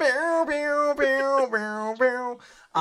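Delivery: high sung bow bow riff; mouth guitar riff. Retold or imitated. Host answers the Layla joke with a sung guitar riff. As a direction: hum the guitar lick with your mouth.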